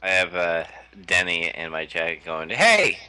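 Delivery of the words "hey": loud high-pitched call; exclaimed. Retold or imitated. Streamer reading Denny's chat message aloud, exclaimed. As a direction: shout it with an exclamation point. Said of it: "with an exclamation point".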